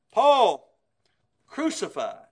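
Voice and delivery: Paul called out loud; a single loud proclaiming Paul; ringing preached shout. Acted. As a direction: throw the name out to the back pew. Preacher builds a list of Pauls.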